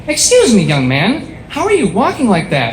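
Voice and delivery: imitating a woman calling out, swooping pitch; mock-baffled female passer-by; incredulous woman. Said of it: "I see this woman".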